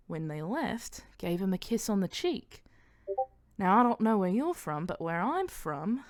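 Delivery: slow, swinging Australian lilt; Australian accent. Acted. Mania telling Bara about the spotted kiss, Australian accent. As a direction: draw the phrases out in Australian. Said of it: "that she turned Australian".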